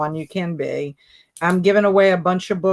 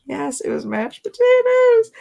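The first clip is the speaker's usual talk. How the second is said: high, loud delighted confirmation; excited high yes; 4 dB louder than usual. Real, not acted. Host cheering the correct guess, high and bright. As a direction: sing out the right answer.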